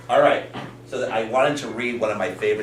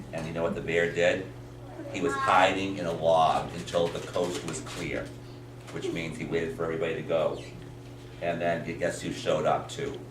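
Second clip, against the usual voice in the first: put-on lower, softer narration to children; hushed storytelling; 5 semitones lower, 6 dB quieter than usual. A narrator's reading. Teacher tells children of bear hiding, low hushed storytelling. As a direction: draw them in with a low, soft telling.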